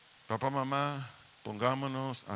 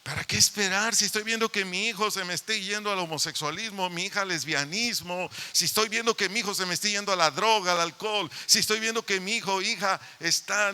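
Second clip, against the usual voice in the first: higher, louder rapid challenge; impassioned preaching; 5 semitones higher, 4 dB louder than usual. Acted. Pastor rebukes parents who wait too long, fervent preaching. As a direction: hammer each "si estoy viendo" with rising fervor.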